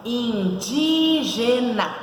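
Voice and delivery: Indígena drawn out very slowly, in, dí, ge, na; split into syllables. Shown as a demonstration. Teacher counting syllables for the class, Indígena slowly syllable by syllable. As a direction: stretch each syllable of Indígena. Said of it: "São quatro sílabas".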